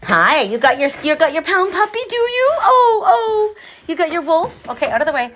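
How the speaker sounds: delighted, squeaky doggy talk; squealing pet talk. Real, not acted. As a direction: gush over the dog's toy. Dog brings her its toy.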